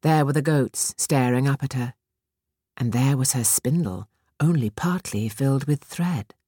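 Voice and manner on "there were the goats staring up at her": ordinary delivery, nothing marked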